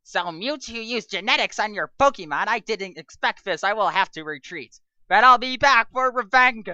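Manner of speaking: loud, rising vow of revenge; hammed-up villain vowing revenge; villain retreat. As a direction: swear you'll be back.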